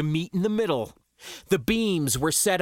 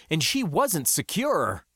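ordinary delivery, nothing marked

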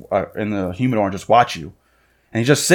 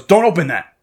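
higher-pitched mock reprimand; scolding; 6 semitones higher, 4 dB louder than usual. Clerk stopping a customer opening a cigar, scolding. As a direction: act out a clerk's sharp warning. Clerk snaps at brother, acted.

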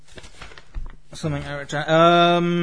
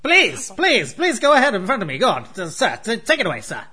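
overly polite fly; 5 semitones higher, 3 dB louder than usual. Pretend. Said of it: "two flies that are very courteous".

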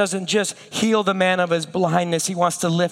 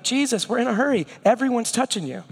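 quick, slightly higher, impatient; hurried disciples; 4 semitones higher, faster than usual. Retold or imitated. Disciples urging Jesus through the crowd, hurried. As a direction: rush it like they're late.

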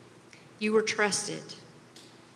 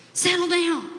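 a higher, anxious plea to calm down; worried father easing a son in a rage; 8 semitones higher than usual. Acted. Father to raging son, worried. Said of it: "with more than a little worry in his voice".

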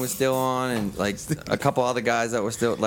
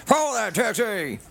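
high, slow shouted command; movie chase shout; 11 semitones higher, slower than usual. Pretend. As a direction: yell it like a movie detective.

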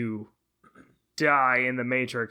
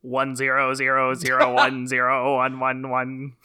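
robot saying binary; 4 dB louder than usual. Pretend. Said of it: "Oh, a binary code".

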